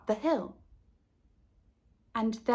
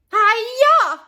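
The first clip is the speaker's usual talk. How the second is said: high, loud karate yell; karate shout; 12 semitones higher, 11 dB louder than usual. A narrator's reading. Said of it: "called the Buckmeisters".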